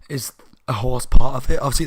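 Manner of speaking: ordinary talk, nothing marked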